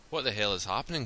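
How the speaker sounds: ordinary delivery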